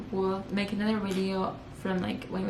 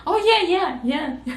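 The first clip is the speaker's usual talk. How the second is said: high, loud, eager yes; retold eager yes, pitched high; eager agreement; 8 semitones higher, 6 dB louder than usual. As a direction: gush a quick yes to please her. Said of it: "and I was like".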